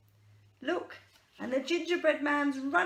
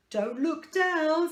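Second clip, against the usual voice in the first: slow, louder, higher warning; troll warning; 5 semitones higher, 5 dB louder, slower than usual. Acted. Troll on the beanstalk warning the others, slow and loud. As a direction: draw it out like a nervous troll.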